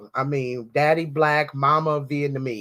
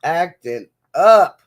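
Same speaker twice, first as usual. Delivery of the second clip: drawn-out, much louder repeat; loud acting up; 10 dB louder, slower than usual. Real, not acted. Telling viewers about the nail shop, loud and slow. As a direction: belt it slowly for emphasis.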